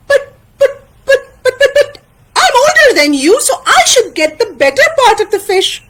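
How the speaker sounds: very high, stammering whine; high-pitched sputtering friend; Taín, whining protest. Acted. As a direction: squeal out a childish objection.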